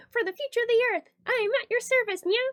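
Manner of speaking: put-on high playful cat girl line; cute cat girl voice. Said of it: "you can definitely get a nya".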